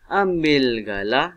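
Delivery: slow, loud "Ambil galah", stopping after "galah"; pause after galah. Shown as a demonstration. Teacher showing pupils where to stop, pause after "galah". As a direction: stretch out "Ambil galah" and halt. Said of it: "Dia kena berhenti".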